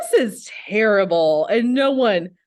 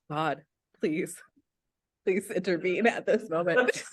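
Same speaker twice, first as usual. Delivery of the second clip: praying quietly; 6 semitones lower, 8 dB quieter than usual. Real, not acted. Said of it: "now I'm praying again".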